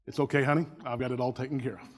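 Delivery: low, soft, unbothered tone; calm reassurance. Acted. Husband to wife in the car, calm reassurance. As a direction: soothe her coolly, playing it down.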